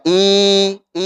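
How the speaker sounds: wrongly drawn-out "i"; hamzah dipanjangkan. Shown as a demonstration. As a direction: hold the "i" far too long as a bad example. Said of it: "Hamzahnya juga demikian, jangan". ("i" dipanjangkan sebagai contoh salah)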